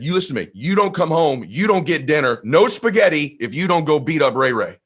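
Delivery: father ordering his son next door, mildly stern; stern dad. Retold or imitated. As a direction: lay down the law. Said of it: "he said".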